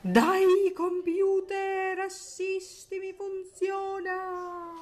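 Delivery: high, drawn-out plea to the computer; begging the laptop to work; pleading. Real, not acted. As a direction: beg the machine, up high and slow.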